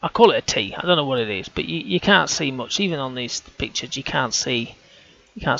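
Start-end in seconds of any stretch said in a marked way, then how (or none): none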